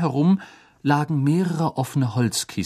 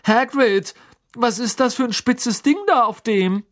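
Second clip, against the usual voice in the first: put-on high, louder girlish question; alarmed Lavender; 13 semitones higher, 5 dB louder than usual. A narrator's reading. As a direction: ask anxiously pointing at a spike. (Lavender, hoch und aufgeregt fragend)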